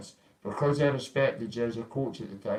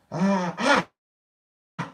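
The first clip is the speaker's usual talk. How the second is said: two high sharp effort shouts; punching grunts; 8 semitones higher, 6 dB louder than usual. Retold or imitated. Acting out hitting the pads, loud grunts. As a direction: grunt as each punch lands.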